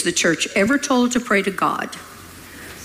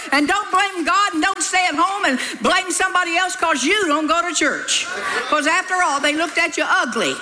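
scolding; 5 semitones higher than usual. Real, not acted. Preacher scolds congregation, laughing.

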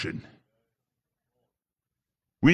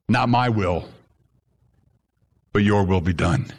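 humble prayer; 6 semitones lower than usual. Pretend. Humble prayer voiced in sermon.

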